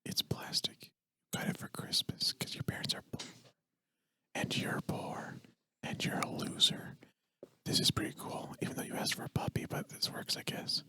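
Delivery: hushed guided-imagery whisper. Pretend. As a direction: whisper them into the daydream. Guided imagery after close your eyes.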